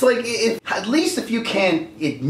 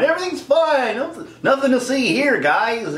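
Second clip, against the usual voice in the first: higher breezy brush-off; mock denial; 7 semitones higher than usual. Studio telling fans nothing's wrong, higher breezy voice. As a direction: wave it away with fake calm.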